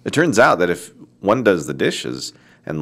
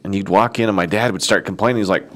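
ordinary talk, nothing marked

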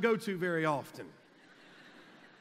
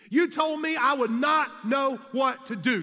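higher and louder pointed accusation; emphatic shout; 6 semitones higher, 6 dB louder than usual. Retold or imitated. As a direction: drive the point home at him.

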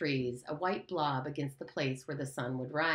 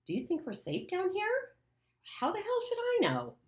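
put-on high, strained boy voices; frightened boy, much higher; scared boys. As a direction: fear pushing the voice up. Frightened boys argue about beast.

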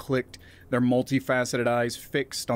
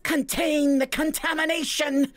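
much higher, raised alien elder's command; plant elder commanding. Acted. Malorian elder orders the council to contain the humans, alarmed. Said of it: "rumbled a Malorian elder".

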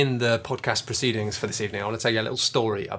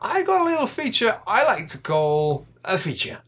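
silly voice; 10 semitones higher than usual. Acted. Silly voice introducing feature.

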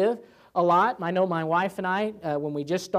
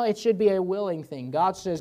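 usual speaking voice

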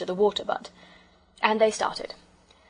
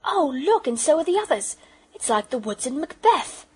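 put-on high, excited child's wonder; excited exclaim; 7 semitones higher, 5 dB louder than usual. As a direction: gasp it out in excitement. Trees walking, child exclaims.

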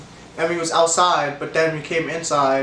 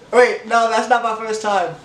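surprised; 4 semitones higher than usual. Real, not acted. Correcting himself, flustered.

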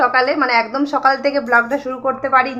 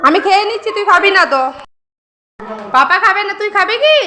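playful teasing; 7 semitones higher, 3 dB louder than usual. Real, not acted.

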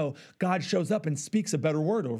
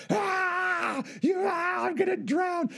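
much higher, hammed-up drowning yelp; mock panic; 12 semitones higher, slower than usual. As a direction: play a flailing, panicking swimmer gasping for help. Mock drowning without floaties.

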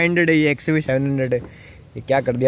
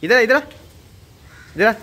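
high, loud call to come back; 7 semitones higher, 4 dB louder than usual. Real, not acted. Calling the boy back. (ऊँची आवाज़ में पुकारना)

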